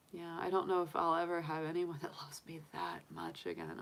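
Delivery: hushed and breaking, almost a whisper; choked near-whisper about losing dad; tearful whisper. Real, not acted. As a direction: whisper it through tears. Grieving late father, tearful.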